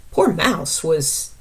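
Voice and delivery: usual speaking voice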